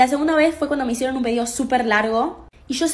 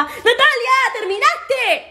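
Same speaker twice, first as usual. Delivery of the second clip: high, louder mock shout of a name; imitated yell; 10 semitones higher, 4 dB louder, slower than usual. Manager yelling at Natalia to finish, mock shout. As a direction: bark the order up high like a boss. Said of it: "te gritaba".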